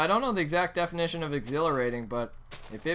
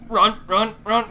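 being chased; 5 semitones higher, 7 dB louder, slower than usual. Real, not acted. Fleeing crocodiles.

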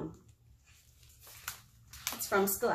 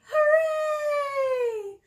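high, loud cheer; shouted hooray; 8 dB louder than usual. Acted. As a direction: shout it out for the kids. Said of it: "shout hooray".

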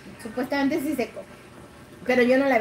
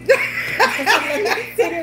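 slow, drawn-out question through laughter, a bit louder; laughing protest; 5 semitones higher, 6 dB louder, slower than usual. Retold or imitated. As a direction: play it indignant but laughing. Friend protests during card game. (protesta lenta entre risas)

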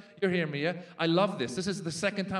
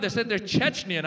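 ordinary talk, nothing marked

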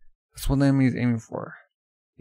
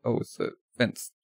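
normal talking voice

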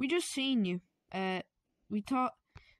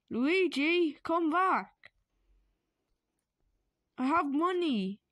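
high, loud call after him; 7 semitones higher, 4 dB louder, slower than usual. Acted. Dying man yelling after Luigi to come back.

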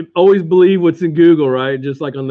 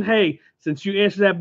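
ordinary talk, nothing marked

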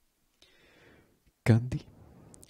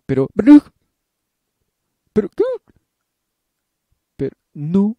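high, loud stammering protest; frantic protest; 13 semitones higher, 17 dB louder than usual. Acted. Candy sputtering loud protests at the screaming headmistress. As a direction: sputter back in panic.

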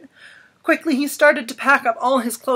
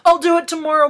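put-on boy's excuse; 7 semitones higher, 7 dB louder, faster than usual. A narrator's reading. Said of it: "Christopher said".

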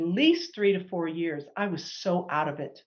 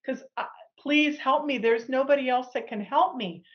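pleading prayer; 6 semitones higher than usual. Retold or imitated. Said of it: "I'm crying out to God going".

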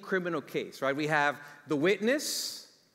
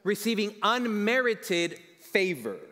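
slow emphasis; 2 semitones higher, slower than usual. Real, not acted. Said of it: "Process this".

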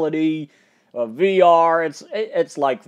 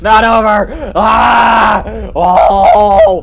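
screaming; 7 semitones higher, 11 dB louder, much slower than usual. Real, not acted. Ride restarts, screaming.

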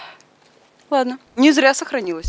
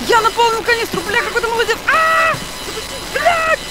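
panicking gamer; 12 semitones higher than usual. Real, not acted. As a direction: shriek in blind panic while fleeing. Chased by monster, screaming.

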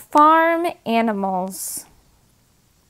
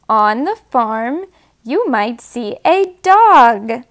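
ordinary delivery

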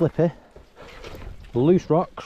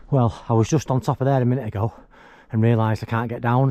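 normal talking voice

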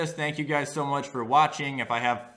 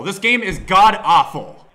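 slow, loud disgusted complaint; angry rant; 3 semitones higher, 9 dB louder, slower than usual. Real, not acted. Gamer cursing the game, slow and loud. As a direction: spit it out slowly in disgust.

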